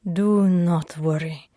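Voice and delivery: lightly voiced Russian man; reassuring Russian accent. Sasha comforting her, hugging.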